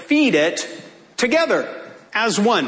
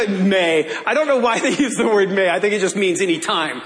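ordinary talk, nothing marked

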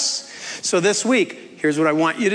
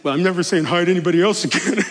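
mock pouting; 3 semitones lower, much faster than usual. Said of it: "rather than".

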